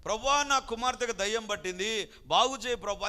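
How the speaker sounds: loud desperate plea to the Lord; pleading cry. Acted. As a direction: cry out for your sick daughter. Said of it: "కేకలేసింది ఆమె".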